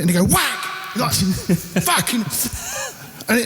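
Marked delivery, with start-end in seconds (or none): 0.3-1.0 high sharp mock drum hit; imitated drum whack. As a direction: mimic the snare crack with your voice. Imitating drummer hitting snare.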